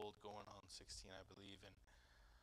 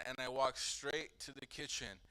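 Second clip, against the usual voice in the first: ordinary talk, nothing marked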